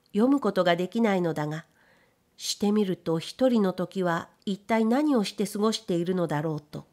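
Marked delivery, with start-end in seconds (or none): none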